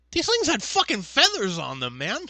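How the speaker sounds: loud, high-pitched mock amazement; mock astonishment. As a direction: exclaim it like astounding news. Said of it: "they're like".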